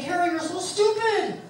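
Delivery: husband mimicking her self-talk, high mock scold; mock self-insult. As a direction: sneer at yourself. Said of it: "you're saying".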